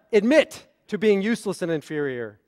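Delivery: "Admit" hit as an order, starting a new sentence; commanding phrasing. Shown as a demonstration. Rabbi reading John's misreading, as a command. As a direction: punch "Admit", then fall to "inferior". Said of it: "but what did John read?".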